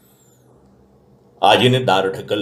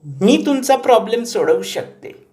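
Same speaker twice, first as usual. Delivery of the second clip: much higher granny voice, insistent; old grandmother; 13 semitones higher, slower than usual. A narrator's reading. Grandmother telling grandson she wants to talk, insistent. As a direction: insist like a determined old lady.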